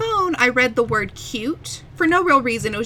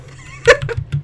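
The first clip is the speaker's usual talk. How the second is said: high burst of laughter; 5 dB louder than usual. Real, not acted. Laughs at own joke.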